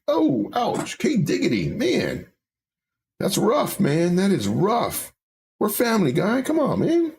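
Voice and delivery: high-pitched, whiny mock offence; mock hurt. Teased by a viewer, he whines back in mock hurt. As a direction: play wounded, pleading with a friend. Chatter jokes that his stream is boring.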